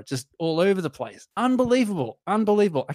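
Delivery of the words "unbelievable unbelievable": higher, awed unbelievable; excited. Real, not acted. Raving to co-hosts about the bass, high and awed.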